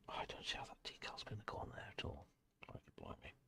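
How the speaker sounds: fast breathless whisper to himself. Real, not acted. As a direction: mutter it under your breath, quickly. Muttering to himself while painting.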